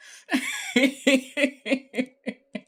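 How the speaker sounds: squealing cackle; high cackling laugh. Real, not acted. Laughing at the scene.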